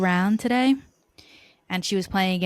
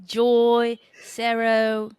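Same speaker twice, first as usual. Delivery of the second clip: echoing a mock Australian announcer; Australian accent; 3 semitones higher, 3 dB louder, slower than usual. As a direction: intone each word separately in a put-on Australian accent. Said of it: "Australian accent".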